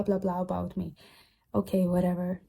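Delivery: normal talking voice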